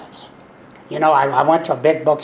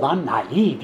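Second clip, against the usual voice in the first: ordinary delivery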